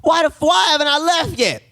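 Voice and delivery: cursing patient. Retold or imitated. Patient demanding to leave the hospital, outraged. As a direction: imitate a man going off at staff. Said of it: "he's cursing like a".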